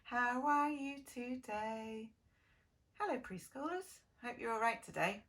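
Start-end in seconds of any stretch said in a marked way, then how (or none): none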